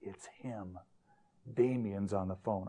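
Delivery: low, very soft aside; hushed warning. Retold or imitated. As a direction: keep it secret from the caller on the line. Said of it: "The secretary cupped her hand over it".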